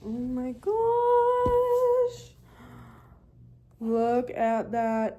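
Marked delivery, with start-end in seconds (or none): 0.0-2.3 high, long-drawn sung-out delight; delighted sing-song. Real, not acted. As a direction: soar up high and hold the last word. Delighted squeal at new laptop.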